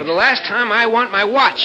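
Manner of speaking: high-pitched, heated ultimatum; angry demand. Acted. Brother demanding his watch back, angry and insistent. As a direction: issue a final warning.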